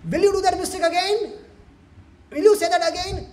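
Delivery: high, loud demand; acted loud interrogation of a naughty child; stern scolding. Playmates scolding crying Krishna.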